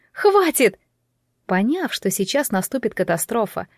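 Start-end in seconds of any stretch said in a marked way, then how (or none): 0.1-0.8 high, sharp cry to stop; alarmed exclamation; urgent outcry. A narrator's reading. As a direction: cut in desperately, pitch jumping up. Girl cuts the parrot off.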